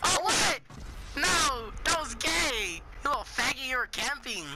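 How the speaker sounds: loud, high outraged yell; outraged shout. Real, not acted. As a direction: shout it like you just got killed. Killed, outraged reaction.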